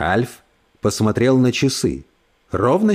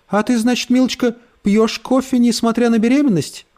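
sugary condescending lady; 9 semitones higher than usual. Acted. Брит Мари колко спрашивает.

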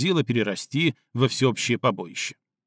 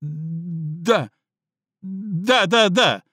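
frightened agreement; 4 semitones higher, much slower than usual. A narrator's reading. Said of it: "быстро закивал головой Филя".

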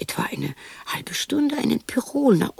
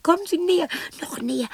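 much higher, inviting call; beckoning; 8 semitones higher, faster than usual. Acted. Woman coaxing the visitor closer in a high voice. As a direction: coax them closer, way up high.

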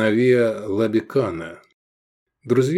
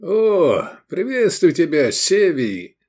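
high, effusive fake welcome; put-on delight; 7 semitones higher, 4 dB louder, slower than usual. A narrator's reading. Owner welcoming his friend, feigned delight. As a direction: greet him with a fake beaming smile. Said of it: "залучился деланной улыбкой".

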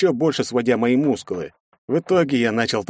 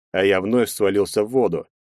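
ordinary delivery

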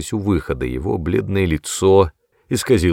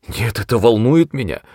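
plain reading voice

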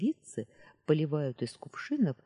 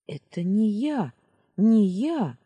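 louder panicked protest; panicked cry; 6 semitones higher, 8 dB louder, slower than usual. Acted. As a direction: cry out in horror at yourself. Panic at mirror. (испуганный отчаянный вскрик)

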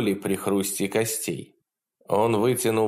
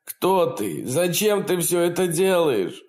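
frightened; 9 semitones higher than usual. Acted. Terrified victim pleads.

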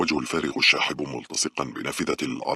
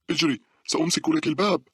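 high-pitched urgent yell; screamed urging; 4 dB louder than usual. A narrator's reading. Girl at the train door screams to her friend, urgent. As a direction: shout it to spur a friend on. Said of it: "صرخت مونيكا".

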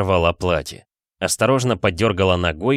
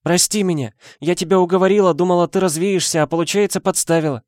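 tearful; 10 semitones higher, 3 dB louder than usual. A narrator's reading. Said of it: "заплакала Настя".

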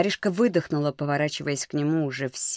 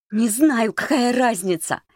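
high, louder exasperated outburst; angry; 8 semitones higher, 4 dB louder than usual. A narrator's reading. Маришка struggling in his grip, angry. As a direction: snap back angrily, pitch jumping up. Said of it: "гневно прошептала Маришка".

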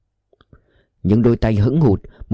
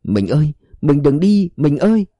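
stunned cry; 4 semitones higher than usual. A narrator's reading. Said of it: "trong lời ông thảng thốt".

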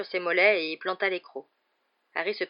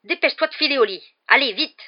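loud, high, urgent command; ordre pressant; 7 semitones higher, 10 dB louder than usual. A narrator's reading. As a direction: rush the boy off to bed. Vernon orders Harry away urgently. (ordre sec et affolé)

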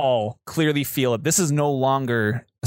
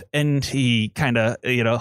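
usual speaking voice

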